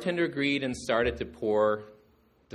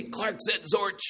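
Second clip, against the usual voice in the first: higher, playful accusing voice; teasing blame; 11 semitones higher than usual. Retold or imitated. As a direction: pin it on Clark with mock innocence. Dad teasingly blames a kid.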